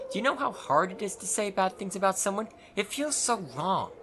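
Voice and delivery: put-on a flustered shy girl who can't say anything mean; timid Nenny.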